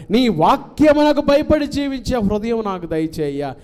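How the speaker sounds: high, loud, drawn-out pleading prayer; fervent prayer. Acted. Preacher voicing David's prayer, loud and high. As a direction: cry out to God for an undivided heart.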